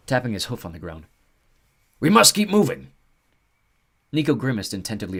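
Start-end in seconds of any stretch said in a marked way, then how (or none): 2.0-2.9 high loud creature urging; urgent centaur. Acted. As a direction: hurry them along. Centaur urges onward.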